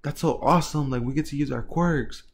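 high-pitched astonished outburst; shocked. Acted. Classmates reacting to a huge throw, shocked. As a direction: voice leaps up on a stunned question, then eases. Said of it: "everybody's shocked".

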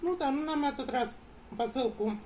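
talking with food in mouth; speaking between bites; chewing while talking. Real, not acted. Seen 0: Speaker unclear; both just took bites and chew while talking.

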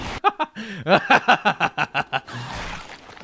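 long staccato villain cackle; drawn-out mock evil laughter; maniacal laugh. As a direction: cackle on and on in triumph. Laughs after killing the enemy.

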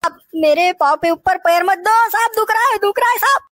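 acted wail of pain; pained pleading. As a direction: plead as your foot is being crushed. Suspect in pain, foot stepped on.

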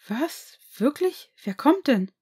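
put-on a delighted teenager can't believe it; excited. Teenage son hearing about a New Year's party, thrilled. Boy hears of surprise party.

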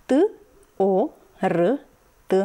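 T, O, R, T as separate short sounds with pauses. Shown as a demonstration. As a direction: sound out T, O, R, T one at a time, consonants with a short uh. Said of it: "patru sunete".

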